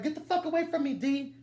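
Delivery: high, fast "Get the fuck away from me"; furious girlfriend. Retold or imitated. Girlfriend pushing away her naked boyfriend, furious. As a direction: shove him off in anger. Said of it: "goes like".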